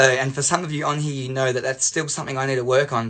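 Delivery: usual speaking voice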